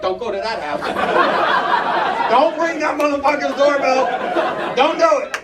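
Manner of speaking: loud, high mock kid warning; kids warning each other. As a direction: shout it like a scared trick-or-treater. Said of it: "they're like".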